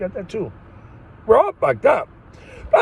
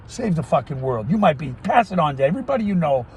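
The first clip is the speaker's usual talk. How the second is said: ordinary delivery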